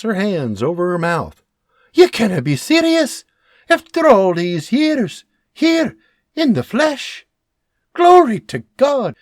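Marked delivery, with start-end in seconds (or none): 1.9-9.2 thrilled. Acted. Mary thrilled to meet Jeremiah.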